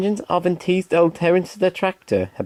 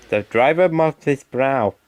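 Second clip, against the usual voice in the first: plain reading voice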